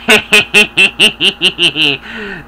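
drawn-out wicked cackle; witch cackle. Acted. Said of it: "How am I going to do this laugh?".

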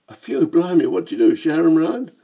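cheeky Cockney "Blimey, mate"; brash London Cockney greeting; Cockney accent. Acted. Lamb remarking on the narrator's accent, in Cockney. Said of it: "a Cockney in Venice".

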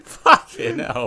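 high, cracking laugh through the swear; laughing shriek. Real, not acted. Friend cracking up at the crash, high laughing curse. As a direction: squeal it out while losing it laughing.

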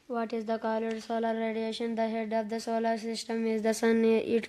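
slow, monotone schoolboy recitation; reading aloud. Acted. Boy studying aloud for his mother, droning recitation. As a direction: drone through the textbook word by word. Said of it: "పైకి చదువు".

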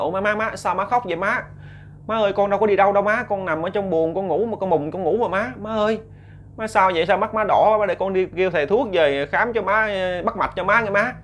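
anxious son begging his mother to tell him what's wrong; worried son. Acted. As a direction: fuss over his mother with rising concern. Son anxiously pleading with crying mother.

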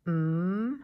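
hummed "Mm", held loud; m sound. Shown as a demonstration. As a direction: hum "Mm" with lips closed. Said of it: "An M says".

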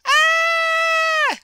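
long, very high loud scream. Acted. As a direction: shriek like a terrified passer-by. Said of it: "a woman who is just walking by screams".

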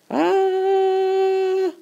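a long, very high excited scream going down the hill; roller coaster scream. Shown as a demonstration. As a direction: let out a thrilled coaster-ride squeal.

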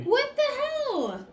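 high, loud, drawn-out shriek; shocked shriek. Real, not acted. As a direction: stretch it out in disbelief. Shocked at pre-cracked egg.

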